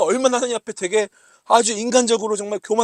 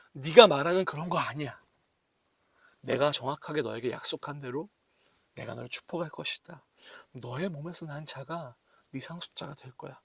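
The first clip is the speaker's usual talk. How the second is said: softer, lower, gentle correction; calm a doubting child with a firm promise; God softly reassuring doubting Abraham; tender reassurance; 5 semitones lower, 6 dB quieter than usual. Acted. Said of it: "하나님이 이렇게 말씀하십니다".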